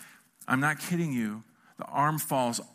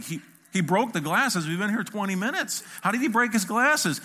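mock-incredulous questioning, climbing higher; disbelieving retold outburst; 5 semitones higher than usual. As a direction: act baffled, pitch rising with each question. Acted disbelief at broken glasses.

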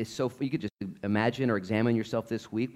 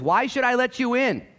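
stern God; 12 semitones higher, 5 dB louder than usual. Acted. God asks at heaven's gate.

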